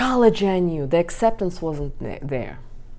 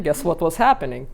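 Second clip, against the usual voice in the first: normal talking voice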